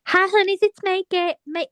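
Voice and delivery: a hammed-up Southern US accent that keeps going Liverpudlian; Gail's Alabama accent. Asked to do Gail, she greets everyone in a put-on Alabama accent. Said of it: "can you give us Gail's accent".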